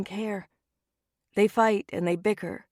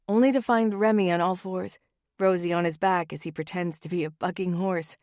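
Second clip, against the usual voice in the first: plain reading voice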